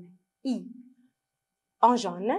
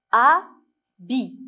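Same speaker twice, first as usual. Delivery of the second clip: "Habit" read as A, long pause, BI; syllable by syllable; 5 dB louder than usual. Shown as a demonstration. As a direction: split "Habit" into two separate beats. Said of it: "on va lire ensemble les mots".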